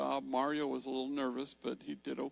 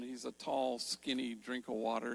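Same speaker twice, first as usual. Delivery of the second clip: normal talking voice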